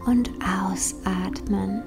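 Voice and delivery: slow, hushed whisper; soft spa whisper. Acted. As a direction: let the words fade out in a whisper. Breathing cue in meditation.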